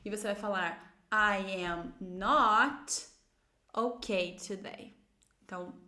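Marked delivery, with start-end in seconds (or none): 1.1-5.0 very slow, with "not" stretched; slow model negative. Shown as a demonstration. Teacher models the negative answer, very slowly. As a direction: draw out "not", then finish lower. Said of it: "você vai dizer usando uma negativa".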